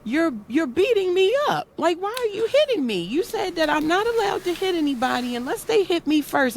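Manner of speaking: put-on high, fast child's voice, protesting; son's protest. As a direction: play her little boy complaining he's being hit. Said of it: "he turned around and was like".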